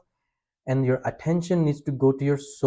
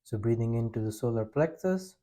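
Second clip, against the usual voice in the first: guided breathing; 3 semitones lower, 7 dB quieter than usual. Acted. Leads a breathing practice.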